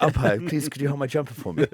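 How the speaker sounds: a light posh-schoolboy greeting; mock polite and meek; public school voice. Mocking a teammate's public school manners, lightly posh. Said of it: "he's so public school".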